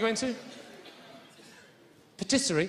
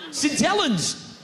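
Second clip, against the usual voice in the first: usual speaking voice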